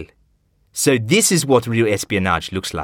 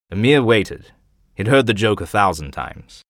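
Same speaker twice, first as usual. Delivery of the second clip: ordinary delivery, nothing marked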